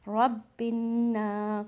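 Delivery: रब्बिन्ना slow, nasal न held; with ghunna. Shown as a demonstration. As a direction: hum the न. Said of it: "गुन्ना करके".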